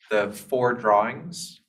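ordinary talk, nothing marked

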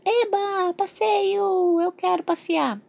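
put-on high-pitched childish cheer; excited baby. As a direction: squeal happily like a little one. Pretend baby cheers at an outing. (bebê animado comemorando)

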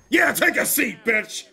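loud, high gloating yell; real taunting shout; triumphant shout. Real, not acted. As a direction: crow it at the batter. Celebrating a strikeout.

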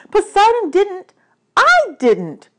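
high, loud, urgent denial; protesting. Acted. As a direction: plead innocence at full voice. Hero protests the accusation.

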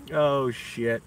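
ordinary talk, nothing marked

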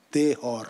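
"Dehor" with the special Lebanese H; Lebanese French H. Shown as a demonstration. Speaker joking about a colleague's accent, Lebanese French sounded H. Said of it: "a special pronunciation for the H".

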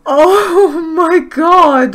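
high, loud, very drawn-out wail of joy; overwhelmed, near tears. Real, not acted. Player overcome by the cute foal, joyful wail. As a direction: wail it slowly, about to cry with delight. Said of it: "gonna cry".

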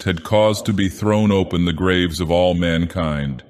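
ordinary delivery, nothing marked

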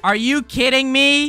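loud, high, slow disbelief; exasperated shout. Acted. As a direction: stretch it out in outraged disbelief. Exasperated at having to go back.

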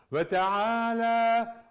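Ve teala slow and loud, the la stretched; long teala. Shown as a demonstration. Teacher showing students the lengthened teala. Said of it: "uzatıldığı için biraz kalınlaştırıyoruz".

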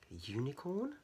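ordinary delivery

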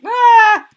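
startled yell. Real, not acted. Sudden exclamation.

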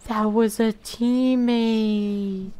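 high, slow horrified disbelief; gasp, then ask in disbelief; shocked gasp. Real, not acted. Teammate killed by mistake.

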